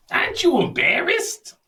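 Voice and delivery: put-on high, loud jokey Chicago accent; playful Chicago accent ribbing; mock Chicago accent. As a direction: tease him in an overdone Chicago accent. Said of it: "that's my Chicago accent".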